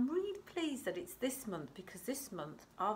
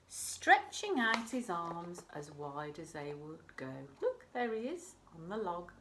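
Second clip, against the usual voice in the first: plain reading voice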